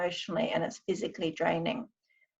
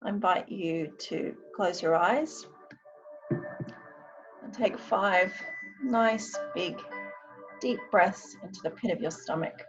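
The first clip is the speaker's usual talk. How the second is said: guided meditation; 6 semitones higher, 4 dB quieter than usual. Acted. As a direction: ease them gently into calm. I'm going to now do a short meditation.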